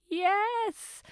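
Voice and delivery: high, drawn-out triumphant yes; retold elation. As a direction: squeal a long, high yes. Retelling her reaction to his acceptance.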